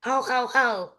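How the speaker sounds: high mock ho ho ho of the raven; mocking imitation. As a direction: parrot mimicking a cackle. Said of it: "Polly mocked".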